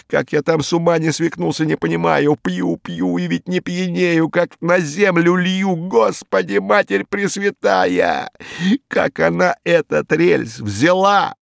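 a scared crook reliving a nightmare, dragging the words; horrified Hinkus. A narrator's reading. Hinkus telling the inspector about the monster, with a touch of horror.

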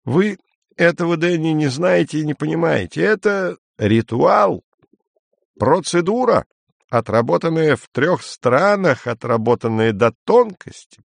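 high-pitched, emphatic character voice; Izya lecturing. A narrator's reading. Izya explains the ritual procedure to Denny, lecturing. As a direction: lecture pompously, drawing out key words.

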